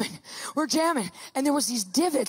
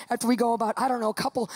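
ordinary talk

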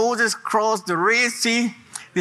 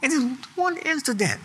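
pleading with God; 4 semitones higher than usual. Acted. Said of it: "I said, I said".

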